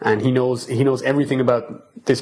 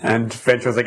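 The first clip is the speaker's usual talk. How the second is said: ordinary delivery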